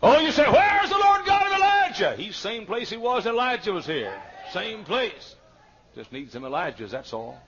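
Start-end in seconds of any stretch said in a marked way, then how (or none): 0.0-5.3 high, loud, ringing cry; preaching shout. Real, not acted. Preacher to congregation, a high ringing shout. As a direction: shout it out to the rafters.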